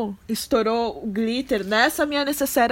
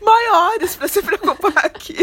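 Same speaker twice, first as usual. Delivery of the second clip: exasperated; 9 semitones higher, 3 dB louder than usual. Retold or imitated. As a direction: talk her down, half exasperated. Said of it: "Eu falei assim".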